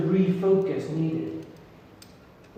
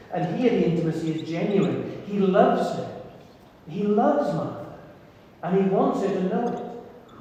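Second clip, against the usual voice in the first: ordinary talk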